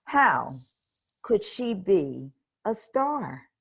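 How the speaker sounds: ordinary delivery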